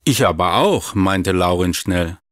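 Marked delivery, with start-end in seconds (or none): none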